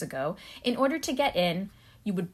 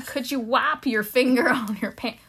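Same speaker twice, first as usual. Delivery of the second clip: put-on Southern accent; 2 semitones higher, 3 dB louder than usual. Repeating the guard's line for a puzzled co-host, Southern accent. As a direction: put on an American Southern security man. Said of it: "Your Southern accent is".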